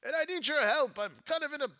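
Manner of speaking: high-pitched, pleading little character; high theatrical plea for help; Sir Talavar calling out. Acted. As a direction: call up to the house in a high, put-on voice. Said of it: "he says".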